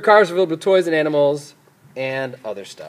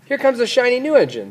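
excited, higher clown call; little clown; 8 semitones higher, 4 dB louder than usual. A narrator's reading. Clown announces a new engine to the toys, excited. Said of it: "said the little clown".

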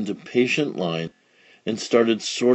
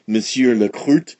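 ordinary delivery

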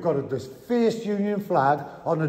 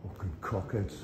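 ordinary delivery

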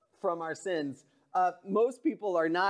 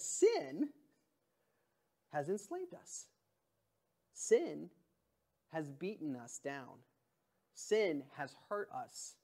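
solemn emphasis; 8 dB quieter than usual. Real, not acted. Pastor naming sin's grip, quiet and grave. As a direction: let each "Sin" land, then hush. Dramatic sermon build.

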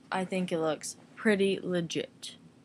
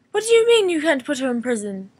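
louder, higher hammed-up outrage; mock indignant; 5 semitones higher, 8 dB louder, faster than usual. Arguing with herself about the dog, loud mock outrage. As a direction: demand an answer like a shocked judge. Acted back-and-forth.

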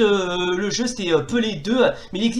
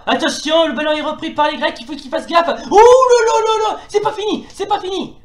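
excited commentator; 12 semitones higher, 7 dB louder than usual. Acted. Dangerous attack in commentary.

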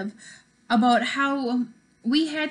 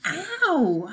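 high pained yelp; mock ow; 6 semitones higher than usual. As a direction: yelp from a punch. Punched in shoulder.

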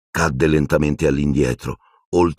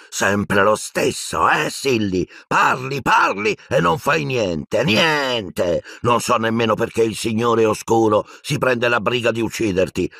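high, scornful rant; violent outburst; 6 semitones higher than usual. A narrator's reading. Death Eater berates helpless Silente, violent and angry. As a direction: rail at the old man in a raised pitch. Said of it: "a un tratto violento".

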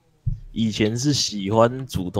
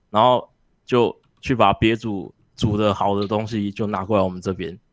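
ordinary talk, nothing marked

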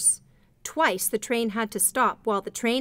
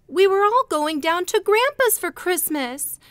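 put-on high, louder child's voice, downcast; doleful little boy; 10 semitones higher, 6 dB louder than usual. A narrator's reading. Oldest boy tells stranded travelers of missed Christmas, doleful child voice. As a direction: sound like a small boy let down. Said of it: "said the little mother's oldest boy dolefully".

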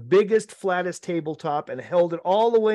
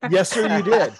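laughing reply; 3 semitones higher, 5 dB louder than usual. Real, not acted.

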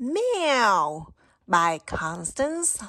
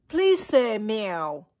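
ordinary delivery, nothing marked

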